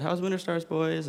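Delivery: high, breezy cheerful greeting; cheerful mom asking lightly, pitched high. Retold or imitated. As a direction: imitate a mom's chirpy welcome home. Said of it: "she said cheerfully".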